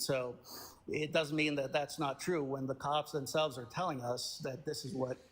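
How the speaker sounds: ordinary talk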